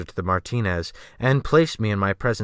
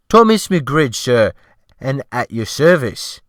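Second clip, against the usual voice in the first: fawning servile cook; 2 semitones higher, 6 dB louder than usual. A narrator's reading. The cook to his new gentleman, fawning and louder. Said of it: "he fawned".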